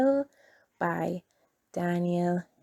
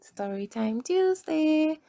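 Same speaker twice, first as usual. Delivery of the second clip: higher, cheerful answer to her own question; excited call-out; 8 semitones higher than usual. Shown as a demonstration. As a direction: sing out the show's name like a cheer. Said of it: "What time is it?".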